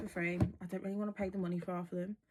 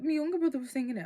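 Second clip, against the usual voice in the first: louder, quicker correcting shout; excited correction; 6 semitones higher, 8 dB louder, much faster than usual. Retold or imitated. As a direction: blurt the fix out fast. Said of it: "I was like".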